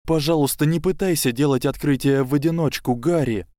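high, pleading with a cracking voice; voice breaking. Acted. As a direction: beg him, voice about to break. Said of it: "срывающимся голосом попросила".

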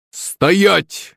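high, drawn-out halt order; shouted command. A narrator's reading. Chef to servant who grabbed a cleaver, crying halt. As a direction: call out a halt, pitch jumping up. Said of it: "крикнул шеф-повар".

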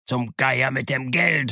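slow, emphatic oath; drawn-out irritated swearing; angry cursing. A narrator's reading. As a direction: curse it out in impatience. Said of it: "fluchte der Blinde".

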